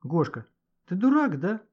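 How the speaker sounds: ordinary delivery, nothing marked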